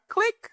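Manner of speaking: squeaky mouth click sound; tiny high-pitched click; high click noise. Retold or imitated. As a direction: mimic the phone hanging up. Imitating hanging up phone.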